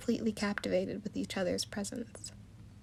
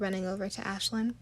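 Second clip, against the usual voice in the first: ordinary delivery, nothing marked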